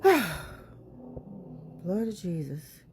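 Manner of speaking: soft, slow sigh then the words; weary sigh, blood of Jesus. Real, not acted. After the abuse allegations, a slow weary sigh. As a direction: breathe it out, drained. Sighing at grim news.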